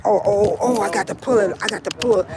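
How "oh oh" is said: ordinary talk, nothing marked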